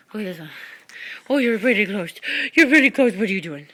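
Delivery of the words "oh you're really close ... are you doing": excited dog talk. Real, not acted. Talking to dog close up.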